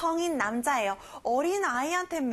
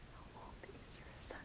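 whispered echo; 27 dB quieter than usual. Shown as a demonstration. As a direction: breathe the question softly. (속삭이듯 따라하기)

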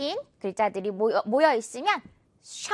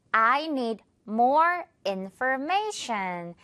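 very slow, clear model sentence; slow it right down for learners; slow teacher model sentence; slow model read; much slower than usual. Shown as a demonstration. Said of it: "teacher models sentence".

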